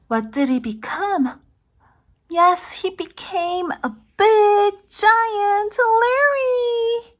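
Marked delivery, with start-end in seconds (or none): 2.3-7.2 higher, slow and grand; slow amazed storybook build; excited reveal. Acted. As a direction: announce it with wonder.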